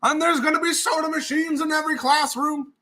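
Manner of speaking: high put-on campaign promise voice; pandering politician. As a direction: promise the moon like a school candidate. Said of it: "someone's like".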